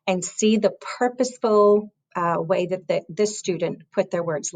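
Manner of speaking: usual speaking voice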